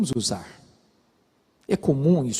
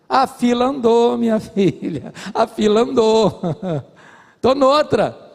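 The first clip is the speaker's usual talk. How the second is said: mocking brush-off; 8 semitones higher, 5 dB louder, slower than usual. Brazilian Job mocking his wife.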